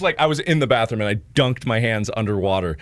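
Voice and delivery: ordinary talk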